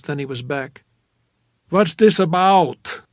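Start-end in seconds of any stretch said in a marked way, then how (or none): 1.7-3.0 big, slow, raised question; jovial, booming actor. A narrator's reading. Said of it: "That jovial, booming actor's voice".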